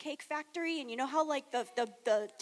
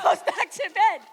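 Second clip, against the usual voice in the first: normal talking voice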